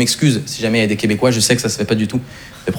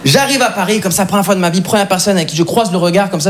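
ordinary talk

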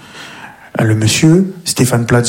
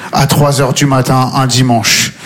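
ordinary talk, nothing marked

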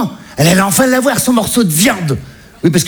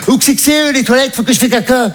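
higher mock mother asking for the loo; a pitched-up, naive mother; mother's voice; 6 semitones higher than usual.

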